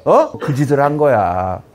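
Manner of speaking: normal talking voice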